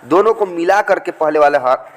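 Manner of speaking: normal talking voice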